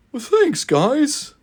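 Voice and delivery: high voice breaking into mock tears; mock tearful. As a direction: choke up like a sappy engine. Booster welling up.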